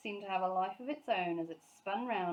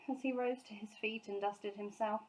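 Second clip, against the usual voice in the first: plain reading voice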